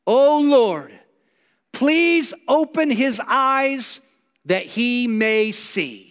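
slow, earnest prayer voice, drawn out; solemn prayer. Acted. Elisha prays for his servant's eyes, slow solemn prayer. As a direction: pray it slowly to the Lord.

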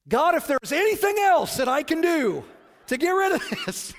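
very high, loud hammed-up begging; loud desperate plea. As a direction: cry out to God for any other way. Pleads loudly with God again.

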